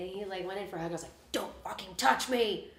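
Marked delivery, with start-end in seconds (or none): 1.3-2.7 slow, deliberate warning snap; snapping at him. Retold or imitated. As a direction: push him away with the words. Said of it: "bitchy and snapping at everything".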